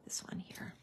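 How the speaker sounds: breathy aside under her breath; whispered to self. Real, not acted. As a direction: whisper it to yourself. Muttering to herself while crafting.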